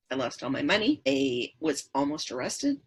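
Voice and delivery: normal talking voice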